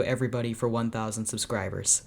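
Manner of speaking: normal talking voice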